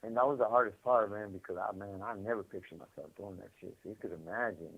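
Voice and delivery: ordinary delivery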